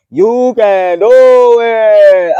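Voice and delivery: high, loud, stretched-out encouragement; excited cheer. Real, not acted. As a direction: yell it like a hype coach. Hyping up viewers.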